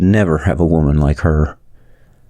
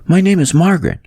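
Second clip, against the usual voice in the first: higher woman's voice, full of thanks; grateful woman; 11 semitones higher than usual. Acted. Rescued woman thanking Adam, a higher grateful voice. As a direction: voice her gratitude, lifted and light.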